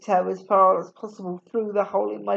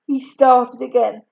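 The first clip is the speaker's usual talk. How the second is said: ordinary delivery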